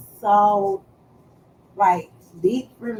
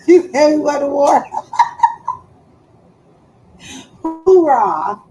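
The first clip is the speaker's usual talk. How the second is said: laughing through the words; cracking up mid-sentence; 6 semitones higher, 7 dB louder than usual. Real, not acted. Laughing at herself over talk of going to war.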